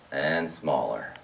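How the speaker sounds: very low, soft, drawn-out "smaller"; deep drop and hush, stretched out; voice shrinking further. Shown as a demonstration. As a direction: sink "smaller" slowly down to a tiny low sound. Last step of pipes getting smaller.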